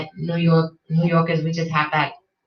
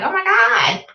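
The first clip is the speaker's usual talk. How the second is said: higher, fussy alarm over her trip; hammed-up overprotective concern; mock worried warning; 11 semitones higher than usual. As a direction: imitate people fretting about Chicago.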